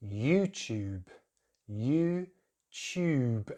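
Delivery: YouTube as YOU-chube; British pronunciation. Shown as a demonstration. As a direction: break YouTube up with a chyoo in tube. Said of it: "it's the way we say tube".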